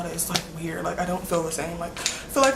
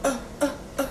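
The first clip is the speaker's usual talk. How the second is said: [name] imitation; 4 semitones higher, 8 dB quieter, much faster than usual. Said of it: "that [name] video when he was like".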